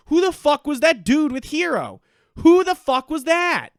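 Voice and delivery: loud, high disbelieving demand; exasperated shout. Real, not acted. Angry rant about confusing character.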